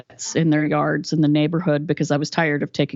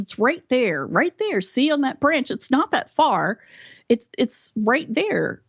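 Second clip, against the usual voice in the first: higher, insistent mother pointing something out; lightly voiced mom, pressing; imitating mom; 7 semitones higher than usual. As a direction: play the mother who can't believe you miss it.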